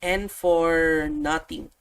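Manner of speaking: ordinary delivery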